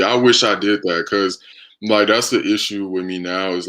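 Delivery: ordinary delivery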